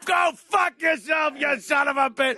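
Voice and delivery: mock mobster rage. Gangster blowing up at the lawn guy within a minute, loud. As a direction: explode at him like a furious gangster. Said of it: "It's like".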